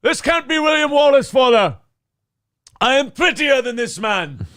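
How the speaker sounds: high, loud Irish movie voice; Irish accent. Acted. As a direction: proclaim it as a Braveheart Irishman. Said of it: "slipping into Irish for Braveheart".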